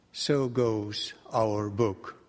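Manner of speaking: ordinary talk, nothing marked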